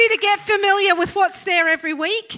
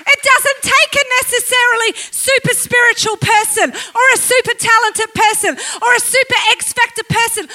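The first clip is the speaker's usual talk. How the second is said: preaching; 3 semitones higher, 6 dB louder than usual. Real, not acted. Preacher declaring willing people can change the world, impassioned preaching. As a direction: declare it forcefully to the whole congregation.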